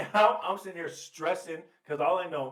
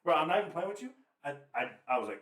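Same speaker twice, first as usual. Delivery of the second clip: normal talking voice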